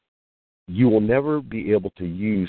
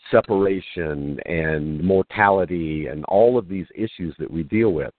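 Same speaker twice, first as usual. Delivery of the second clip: ordinary delivery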